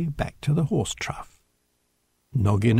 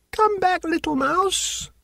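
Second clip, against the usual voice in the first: put-on children shouting. A narrator's reading. Children chasing a runaway mouse, high shouting. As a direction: call after it in a high child's voice. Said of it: "shouted the children".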